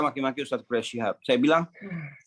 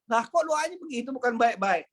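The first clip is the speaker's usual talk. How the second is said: higher, plaintive student's protest; student upset by a harsh prayer, pleading higher; 10 semitones higher than usual. Retold or imitated. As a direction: whine it like a hurt pupil. Crying student protests teacher's prayer.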